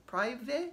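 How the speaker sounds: Privé with a questioning rise, unsure of the sound; unsure French. Real, not acted. Doubting her French, Privé asked uncertainly. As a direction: try Privé hesitantly as a question. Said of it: "I don't know, I'm not French".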